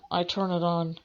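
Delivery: normal talking voice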